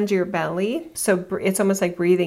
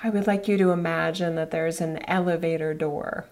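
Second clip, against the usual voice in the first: guided meditation; 3 semitones lower, 3 dB quieter than usual. Acted. Guides elevator visualization meditation.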